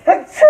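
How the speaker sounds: loud sudden sneeze; sharp high achoo. Real, not acted. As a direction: let a real sneeze burst out. Sneezes mid-sentence while cooking.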